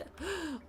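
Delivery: sharp gasp of surprise; surprised gasp. Real, not acted. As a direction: gasp in shock. Shocked by news.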